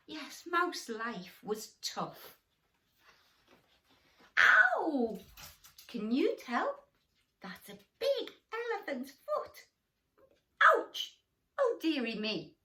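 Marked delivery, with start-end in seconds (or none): 4.4-5.2 high loud squeaky ow; mock cry of pain. Mouse trodden on. 10.6-11.2 high loud squeaky ow; mock cry of pain. Zebra sits on mouse.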